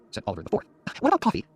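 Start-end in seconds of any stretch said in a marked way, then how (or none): 1.0-1.4 much higher mother's voice; quick interjection. Acted. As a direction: cut in quickly, pitched high. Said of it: "my mother said quickly".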